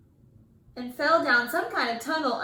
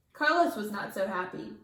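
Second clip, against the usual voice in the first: usual speaking voice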